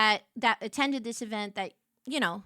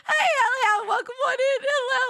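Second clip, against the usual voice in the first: high, loud, cracking with laughter; greeting the driver while laughing; 13 semitones higher, 7 dB louder than usual. Retold or imitated. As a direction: sing out hello through giggles.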